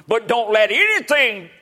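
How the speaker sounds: high-pitched, urgent exhortation; impassioned preaching. Real, not acted. Preacher warning friend to let nothing intervene, high preaching cry. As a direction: cry it out as an appeal.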